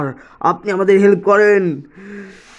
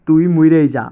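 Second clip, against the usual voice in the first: normal talking voice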